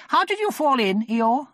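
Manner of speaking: high, loud questioning character voice; Rabbit. A narrator's reading. Rabbit asking Eeyore about the fall, high voice. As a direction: pitch it up and ask firmly.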